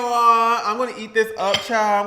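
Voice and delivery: hyped. Real, not acted. Signing off, hyped to eat.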